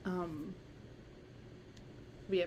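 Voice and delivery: ordinary talk, nothing marked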